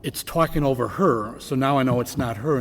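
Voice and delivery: ordinary talk, nothing marked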